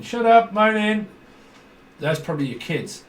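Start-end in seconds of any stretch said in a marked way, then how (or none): 0.0-1.1 high, loud, slow scolding; loud scolding put-on voice; telling off. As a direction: snap at the moaner like a fed-up parent. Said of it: "I can hear someone moaning".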